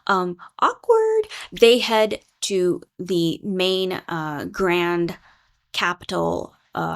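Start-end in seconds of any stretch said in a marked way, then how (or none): 0.0-1.3 squeaky high, slow "awkward"; Perry realizing she snagged Theo, squeaky awkward; awkward aside. Retold or imitated. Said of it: "Perry realizing".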